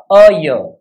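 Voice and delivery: ordinary delivery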